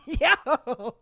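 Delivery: ordinary delivery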